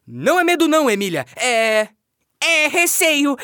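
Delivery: put-on high, loud boy hero; defensive young boy voice; Pedrinho voice. Boy hero answers the doll.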